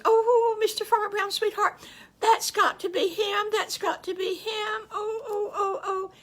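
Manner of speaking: very high, loud, near-tearful excitement; overjoyed squeal. Acted. Farm wife hearing the wolf knock at the door, very high, loud and teary with joy. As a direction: squeal it like she can't contain herself. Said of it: "they were so excited".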